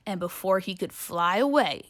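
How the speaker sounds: plain reading voice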